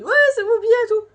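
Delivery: high, loud mocking imitation. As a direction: parrot the critics in a shrill mock. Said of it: "Venez pas m'engueuler en mode".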